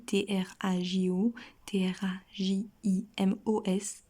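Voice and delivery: measured letter-by-letter dictation for learners; slow spelling. Shown as a demonstration. Teacher spells out verb forms.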